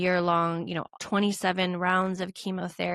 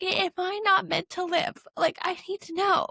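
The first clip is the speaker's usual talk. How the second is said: higher, pleading and close to tears; tearful anguished plea; 11 semitones higher than usual. Retold or imitated. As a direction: cry out to God, hurt and desperate. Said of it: "I'm so mad at you".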